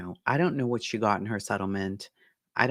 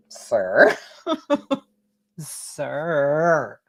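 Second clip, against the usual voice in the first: incredulous sir; 4 semitones higher, 4 dB louder than usual. Real, not acted. Disbelieving address to absent man.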